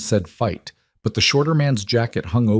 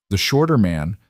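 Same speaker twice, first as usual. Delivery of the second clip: plain reading voice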